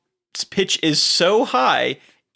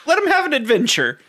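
put-on old-timer voice; 7 semitones higher than usual. Mock back-in-my-day old-timer.

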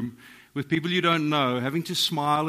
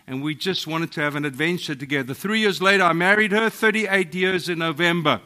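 ordinary delivery

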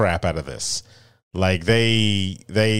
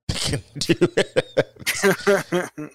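much higher, drawn-out comic voice, breaking into laughter; high-pitched laughing repeat; 9 semitones higher, much slower than usual. Pretend. Co-host joking about giving up on a show, high laughing voice. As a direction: squeak it out slowly and laugh.

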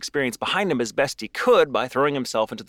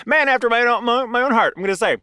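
high and louder, still laughing; laughing fond admiration; 11 semitones higher, 6 dB louder than usual. Real, not acted. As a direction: gush while laughing. Laughing over the stepfather's reaction.

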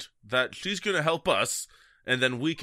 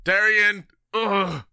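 raised, higher calls broken by a groan; shouting for someone; 6 semitones higher, 4 dB louder, slower than usual. Acted. Wounded player leaving room seeking Darian, shouting. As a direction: shout the name down the hall. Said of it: "start kinda shouting".

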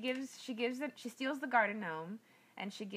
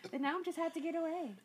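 mock breezy excuse; 7 semitones higher, faster than usual. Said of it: "So she's like".

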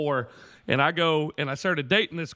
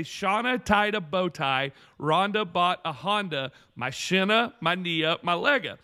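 teaching a saying. Retold or imitated. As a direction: spell it out phrase by phrase. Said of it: "I have this little saying".

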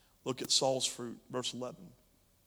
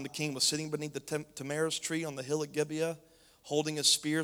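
ordinary talk, nothing marked

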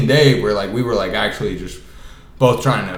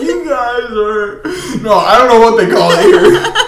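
laughing through it; 9 semitones higher, 6 dB louder than usual. Retold or imitated. Theo retries his answer to the hosts, laughing through it. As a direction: try to talk while cracking up. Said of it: "they just start dying laughing".

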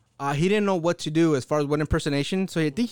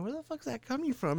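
distant offstage voice; 6 semitones higher, 11 dB quieter than usual. Retold or imitated. Offstage voice heard, quieter.

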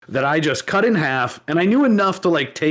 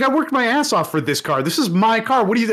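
high, insistent retold protest; worked-up mock outrage, pitched high; imitated indignant outburst; 8 semitones higher than usual. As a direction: act out an owner fed up with accusations. Acting falsely accused car owner.

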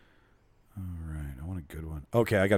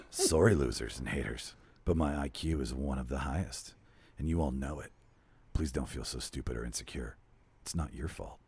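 low, seductive read; sexy voice; 6 semitones lower, 5 dB quieter than usual. Acted. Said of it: "Okay, I'll go to my sexy voice".

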